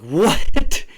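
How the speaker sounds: high, loud stunned "What?"; stunned yelp at his friend; loud disbelief. Retold or imitated. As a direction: blurt it out in shock.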